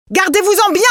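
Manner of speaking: high and loud, frantic; desperate protest. A narrator's reading. Wife refusing the golden case, high and frantic. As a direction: cry him down in panic. Wife threatens to kill herself.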